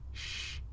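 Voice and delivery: hissed shush; sharp shush. A narrator's reading. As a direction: hush someone urgently. Hushing someone at night.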